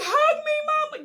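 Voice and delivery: loud, high-pitched plea; yelling for mama. Retold or imitated. Calling to her mother while being arrested, yelling. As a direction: cry out desperately for your mother. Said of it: "I'm like yelling this shit".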